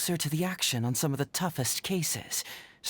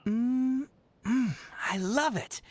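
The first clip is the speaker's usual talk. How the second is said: savoring food; 7 semitones higher, slower than usual. Acted. Tasting a dish.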